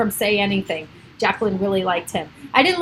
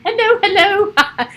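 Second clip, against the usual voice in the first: much higher, slow cooing hello; 12 semitones higher, 6 dB louder than usual. Real, not acted. Greeting baby rabbit.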